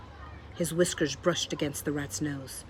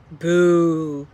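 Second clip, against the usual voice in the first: much louder jeering boo; 12 dB louder than usual. A narrator's reading. Botticelli jeering again with a loud boo. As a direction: jeer at him with full voice. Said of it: "shouted Botticelli again".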